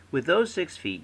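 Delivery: ordinary talk, nothing marked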